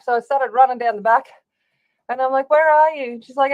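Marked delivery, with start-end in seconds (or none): 2.5-3.1 calling out. Retold or imitated. Calling out to woman on river.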